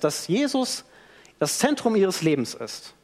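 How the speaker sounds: usual speaking voice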